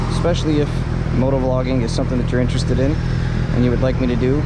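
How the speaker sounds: muffled through helmet mic; talking into helmet mic while riding; inside a helmet. Real, not acted. Moto vlog recorded inside helmet.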